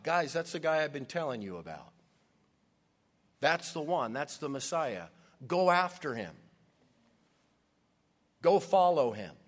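rising to a loud call on Go follow him; excited urging. Acted. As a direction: push them to go after him. John urging disciples, re-enacted.

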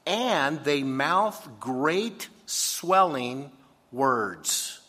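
slow and swelling, very slow; slow, drawn out. Acted. Preacher reading scripture about boasters, slowly. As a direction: stretch it out to show the swelling words. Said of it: "incredibly exaggerated".

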